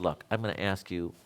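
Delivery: low, soft, worn-out warning through the teeth; a tired teacher's last warning; frustrated warning. Retold or imitated. Tired teacher warns stubborn student, low and soft. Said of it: "I'm getting really frustrated".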